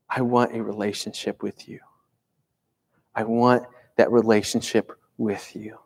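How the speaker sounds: soft and hushed. Acted. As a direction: speak it gently into someone's darkest moment. Jesus speaking in dark times.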